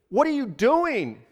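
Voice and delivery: stunned, drawn-out shout of a question; calling out. A narrator's reading. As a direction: call down to someone dangling below. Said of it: "he called out to the dangling man".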